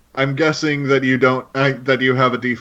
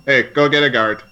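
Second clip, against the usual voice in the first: lightly voiced Wisconsin bartender; a hint of Wisconsin accent. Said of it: "That's the most Wisconsin accent ever".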